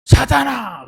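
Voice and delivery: loud, high sharp rebuke; stern rebuke. Retold or imitated. Jesus rebuking Peter, loud and sharp. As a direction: bark the name like a scolding. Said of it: "아주 심하게 질책을 했어요".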